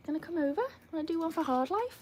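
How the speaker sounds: high, soft pet-talk; coaxing a dog. Real, not acted. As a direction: invite the dog over, high and quiet. Asking the dog to perform.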